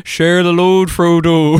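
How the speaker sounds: loud, high hammed-up Irish accent; mock Irish accent. Teasing the co-host's reading, in a loud mock Irish accent. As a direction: mimic the accent big and loud, laughing at it. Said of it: "that accent, I couldn't stop laughing".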